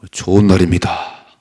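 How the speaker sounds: very loud, emphatic proclamation; firm declaration. Shown as a demonstration. Preacher demonstrating the words to say on waking, loud declaration. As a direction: proclaim it with full volume. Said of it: "이렇게 선포하셔야 됩니다".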